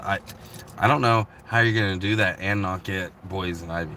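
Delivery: ordinary delivery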